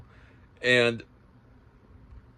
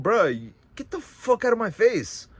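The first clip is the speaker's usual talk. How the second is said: much higher, angry get out of my face; retold snap at a thief; angry dismissal; 10 semitones higher than usual. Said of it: "I was like".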